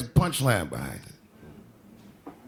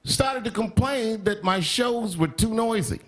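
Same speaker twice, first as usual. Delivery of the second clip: ordinary talk, nothing marked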